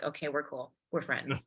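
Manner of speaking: soft, low-key making up; appeased horse, imitated. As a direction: say it quietly, as a mollified horse.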